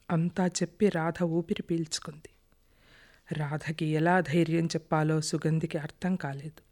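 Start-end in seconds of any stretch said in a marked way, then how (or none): none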